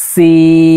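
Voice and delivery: সিন chanted long with breath on the hiss, four counts; long drawn sin. Shown as a demonstration. Tajweed teacher chanting সিন, long. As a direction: stretch সিন slowly, air flowing. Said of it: "চার আলিফ টান হবে".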